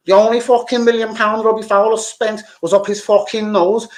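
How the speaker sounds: usual speaking voice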